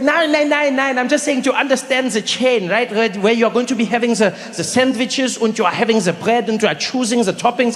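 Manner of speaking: put-on high, insistent German-accented explaining; protest, then explain in a German accent. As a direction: imitate a fussy German friend. Rolf objects in accent.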